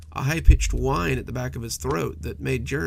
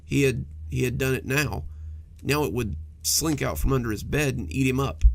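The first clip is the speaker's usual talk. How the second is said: ordinary delivery, nothing marked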